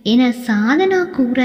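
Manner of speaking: ordinary delivery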